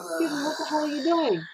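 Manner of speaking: high-pitched retold outrage; indignant confrontation. Mother to kid who punched her son, indignant.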